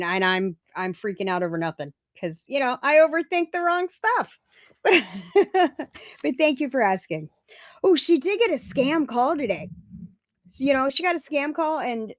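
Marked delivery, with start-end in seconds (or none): 4.8-6.2 laugh. Real, not acted. Laughing at her own overthinking.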